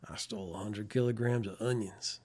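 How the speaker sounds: put-on light Midwestern accent, low and soft; a hint of Midwestern twang. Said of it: "almost like a Midwestern accent there for a bit".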